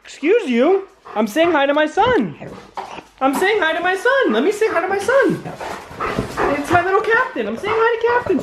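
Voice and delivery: very high, sing-song pet voice, half scolding; dog-talk voice. Real, not acted. Owner fussing over his jumping dogs in high pet voice. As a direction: coo and fuss at the dogs like babies.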